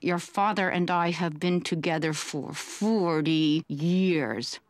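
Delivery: low, slow, tight-jawed mom voice. Retold or imitated. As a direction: force words through a clenched jaw. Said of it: "my mom could barely get the words out".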